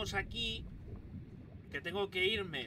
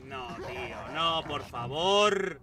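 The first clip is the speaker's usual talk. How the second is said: 1 súplica gritada; 2 semitones lower, 6 dB louder, much slower than usual. Real, not acted. Suplica al morir.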